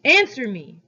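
sharp loud shout; cry. A narrator's reading. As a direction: bark it out to make the boy jump. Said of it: "he cried, making me jump".

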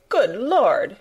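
sudden alarmed cry; startled exclamation. A narrator's reading. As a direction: gasp it out in shock. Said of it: "exclaimed poor Missus Medlock".

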